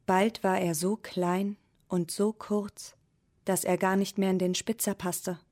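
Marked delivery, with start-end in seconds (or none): none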